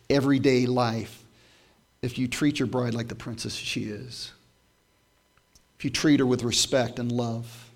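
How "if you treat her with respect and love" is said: ordinary talk, nothing marked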